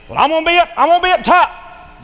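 high, loud boasting voice; boastful Satan. Acted. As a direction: crow it like a proud upstart.

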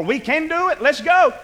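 high, eager acted rally; rallying minority. Preacher voicing the minority's report, eager rallying. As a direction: urge everyone to set off. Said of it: "minority says".

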